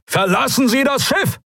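high, desperate roar of a name. A narrator's reading. The admiral roars to his friend to abandon ship. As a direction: bellow the name across the bridge. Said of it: "brüllte Telios".

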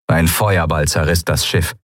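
ordinary delivery

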